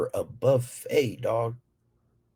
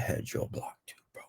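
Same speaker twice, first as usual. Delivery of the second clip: whispered; 12 dB quieter than usual. Real, not acted. Whispered aside.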